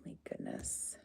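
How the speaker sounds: breathless whisper of disbelief; awed whisper. Real, not acted. Streamer overwhelmed by a donation, awed whisper. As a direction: hush it, still overwhelmed.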